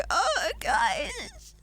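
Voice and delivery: a bird of death croak; sick voice demo. Retold or imitated. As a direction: squeeze it out like a sick, broken voice. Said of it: "that's how my voice was".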